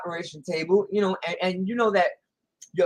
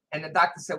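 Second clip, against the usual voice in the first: ordinary talk, nothing marked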